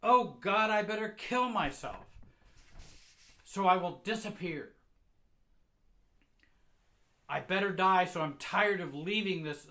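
high, loud tirade, easing slightly at the end; desperate angry shout. Acted. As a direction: yell it out in fed-up despair. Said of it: "She shouts".

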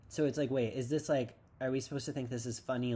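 usual speaking voice

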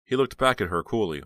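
plain reading voice

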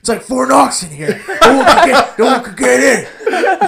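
loud, high, hammed-up hollering; mocking old customers. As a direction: bellow the tired joke like you invented it. Hammed-up old customers repeating the joke.

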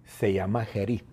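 ordinary talk, nothing marked

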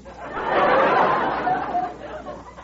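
a high, loud real laugh; high burst of laughter. Real, not acted. [name] laughing at Irma's complex remark, high and loud.

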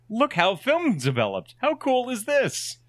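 high, gee-whiz enthusiasm; mock amazement. Showing passersby how film develops, mock-excited high voice. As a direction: show it off with exaggerated wonder, pitch climbing.

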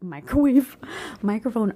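real amused outburst; amused self-correction. Real, not acted. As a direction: blurt it and burst out laughing. Laughs at own slip of tongue.

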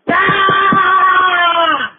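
high, drawn-out "aaah" scream; long frustrated scream. Acted. Caller ending his rant at his cats, long frustrated scream.

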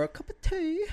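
hammed-up English accent with a laugh; teasing fake English accent; mock English accent. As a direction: put on a silly British accent. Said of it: "a English accent".

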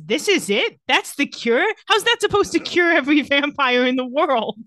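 can't believe the cure is that small; imitating Jeremy's over-the-top disbelief; incredulous Jeremy. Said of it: "the way he delivers this line is insane".

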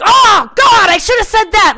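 exasperated high shout; loud, high-pitched outburst of regret; George. Retold or imitated. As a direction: wail it loudly in frustration at missing your chance. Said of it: "he's like".

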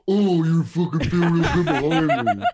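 high, loud mocking singsong; over-the-top taunting repeat; exaggerated mocking. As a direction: mimic them back in a jeering voice. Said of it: "say it back in the mocking tone".

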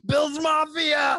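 high, drawn-out Southern battle cry; slow Southern-accented rallying yell; Southern fan shout. Acted. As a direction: holler it like a rowdy Southern fan. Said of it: "I gave him a Southern accent".

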